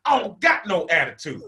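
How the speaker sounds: hammed-up sassy comeback; full of attitude. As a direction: deny it with obvious attitude. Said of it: "but I said it like that".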